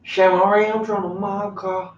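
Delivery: high voice, mouth full of gold. Shown as a demonstration. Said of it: "say it like your mouth is full of gold".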